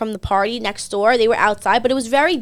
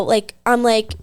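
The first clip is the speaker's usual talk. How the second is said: normal talking voice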